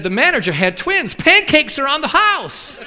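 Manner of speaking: high, loud and slow, beaming. Retold or imitated. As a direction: announce the news with glee, drawn out. Hostess explains the lucky day.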